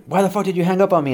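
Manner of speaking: high, fast mock outburst; rapid high-pitched demand; enraged dad. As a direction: imitate a father furious at being cut off. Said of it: "drove him fucking insane".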